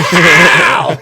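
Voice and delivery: loud "pow" for a slap; shouted slap noise. Retold or imitated. Acting out slapping his roommate, shouted slap noise. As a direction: shout the impact out high and hard.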